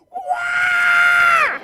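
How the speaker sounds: loud, very high cartoon scream; long piercing mock wail of a thrown puppet; flying puppet head's wail. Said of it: "make the head go".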